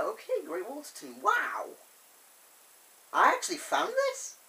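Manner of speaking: high-pitched voice climbing into wonder; mock amazement. Thomas learning he found Great Waterton, acted amazement.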